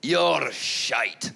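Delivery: drawn-out Scottish heckle; Edinburgh heckler. Retold or imitated. Edinburgh punter heckling the comic at two a.m., mock Scottish accent. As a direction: imitate a blunt Scottish drunk, stretching the words. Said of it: "One was in Edinburgh".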